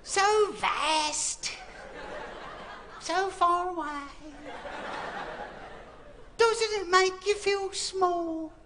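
breathy-free high, slow dreamy English lady voice; romantic English accent. Retold or imitated. A young English lady swooning at the stars, high and slow in an English accent. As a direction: gush over the stars in a posh English accent. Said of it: "I don't know why she's English".

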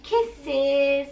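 high, loud kisses; bright loud sign-off. Real, not acted. Mother sending kisses to her followers, high and loud. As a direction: beam it out to the viewers.

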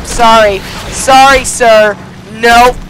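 loud, high refusal; defiant shouting. Real, not acted. Gamer refusing to be shot down, defiant shouting. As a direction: shout each word at the attacker.

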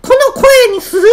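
comically high-pitched falsetto; jokey high demo voice; pulled-up voice. Shown as a demonstration. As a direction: squeak as if vocal cords were pulled tight. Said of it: "って引っ張って、".

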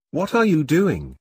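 ordinary delivery

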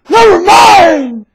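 high, slow, shouted dismissal in a mock mom voice; hammed-up high shouted brush-off. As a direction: shut the kid down like an annoyed mother. Mom brushing off child's question.

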